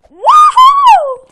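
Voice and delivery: high, loud victory whoop; whooping cheer. Real, not acted. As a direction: cheer like you just won. Celebrating getting a gun.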